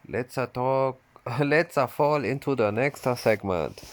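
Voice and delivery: lightly voiced Japanese accent, playful. Shown as a demonstration. Reading the host's intro in a Japanese accent. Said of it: "I should say it in a Japanese accent".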